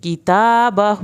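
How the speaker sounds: slow recitation; long standing fatha. Shown as a demonstration. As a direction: stretch the taa of "کتابہ". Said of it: "کھڑی زبر لمبی ہو رہی ہے".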